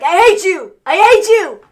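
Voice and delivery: high, loud mock wail; crying outburst. Host acting out crying Jay at Roman, loud fake-tearful yell. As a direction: yell it like a hammed-up tearful tantrum. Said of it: "he's crying and he's like".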